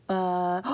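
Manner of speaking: loud clipped "Bu-" alone; isolated first sound. Shown as a demonstration. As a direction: cut "Bu-" off right at the first sound. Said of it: "stop when you hear that bu sound".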